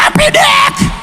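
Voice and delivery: very high shouted denunciation of Impudique; preacher's shout. Shown as a demonstration. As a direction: fling Impudique out as a pulpit cry. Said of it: "je vais pas te dire". (Impudique crié très aigu, en prêcheur)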